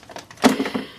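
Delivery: long weary sigh; heavy sigh. Real, not acted. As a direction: let out a tired sigh. Sigh alone on line.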